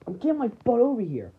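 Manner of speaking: ordinary delivery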